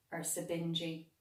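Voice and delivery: usual speaking voice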